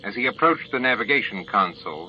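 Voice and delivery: plain reading voice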